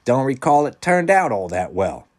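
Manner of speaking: high, loud, pointed doubt; sarcastic musing. A narrator's reading. Wendell poking holes in Peter's island plan, sarcastic. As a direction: feign vague recall, sarcastically. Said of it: "Wendell mused sarcastically".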